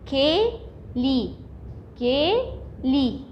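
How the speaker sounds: "كيلي" very slow, a gap between syllables; teacher breaking "كيلي" into long, separate syllables; split into syllables. Shown as a demonstration. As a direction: sound out "كيلي" in two drawn-out parts. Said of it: "تعالوا نقسمها".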